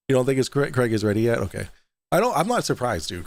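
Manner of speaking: ordinary talk, nothing marked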